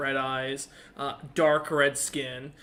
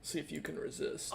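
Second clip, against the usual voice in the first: soft unvoiced whisper; hushed, teasing whisper to the player; whispered dare; 11 dB quieter than usual. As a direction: breathe the challenge. GM whispers a challenge.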